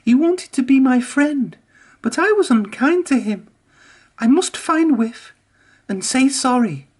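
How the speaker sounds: high, remorseful resolve; Emily's voice. A narrator's reading. Emily decides to find Whiff, high and sorry. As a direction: own the mistake, high.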